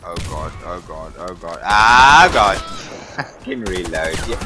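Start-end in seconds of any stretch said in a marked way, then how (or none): 1.6-2.6 frustrated yell. Real, not acted. Death in game, frustrated yell.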